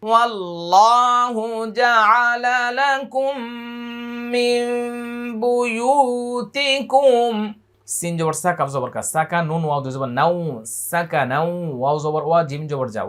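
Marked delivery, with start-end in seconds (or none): 0.1-7.4 slow, high melodic tilawat; Quran recitation. Shown as a demonstration. Teacher chanting the verse for students, slow and high. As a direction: chant each word long and high.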